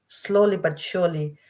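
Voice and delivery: normal talking voice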